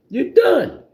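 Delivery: high, sassy "you're done"; mock dismissal. Reader waving off a cheating ex, high mock voice. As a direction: fling it off in a put-on high voice.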